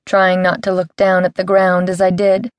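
ordinary delivery

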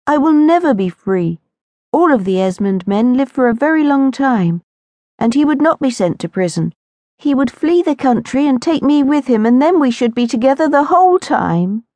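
put-on high, strained girl's voice full of resignation; a trapped girl voicing hopelessness, pitched high; despairing young Lucinda. A narrator's reading. Lucinda explains to Mary why she'll never be free, high and resigned.

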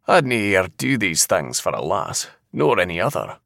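ordinary delivery, nothing marked

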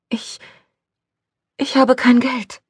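put-on hesitant, high girl's voice; falter, then admit it anxiously; timid stammer. A narrator's reading. Frightened girl stammers to stranger.

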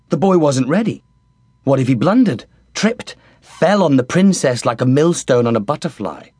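plain reading voice

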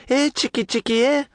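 put-on high, taunting young woman voice; mocking lass. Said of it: "she says".

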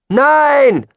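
very high, loud drawn-out cry; crowd shout. Acted. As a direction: yell it like a whole crowd. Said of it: "riefen viele Stimmen unisono". (lauter, hoher Aufschrei der Menge)

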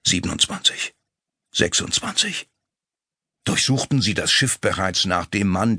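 ordinary delivery

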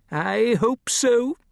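high, slow worried reply; lightly voiced nervous gentleman; uneasy gentleman. A narrator's reading. Larson's voice in story.

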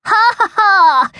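high, loud mocking "ha ha ha"; jeering laugh. A narrator's reading. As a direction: laugh in someone's face. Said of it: "jeered a voice".

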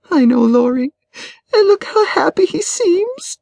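high, tearful voice of a hurt wife; choking up. A narrator's reading. Wife shows her friend how happy he seems, choking up. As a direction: let the pitch climb as you choke up. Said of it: "choking up".

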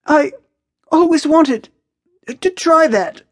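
high, halting, strained after coughing; squeezed-out words. A narrator's reading. Maya recovering from choking on smoke, squeezing words out. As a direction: force it out in broken pieces, high. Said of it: "manages to squeeze some words out".

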